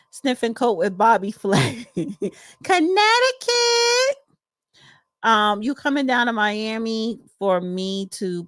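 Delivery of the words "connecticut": very high, drawn-out city call; stretched-out high shout-out; roll-call whoop. Real, not acted. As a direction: sing the place name out high and long. Calling out a viewer's city.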